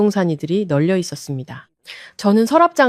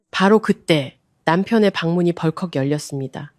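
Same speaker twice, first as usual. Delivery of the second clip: plain reading voice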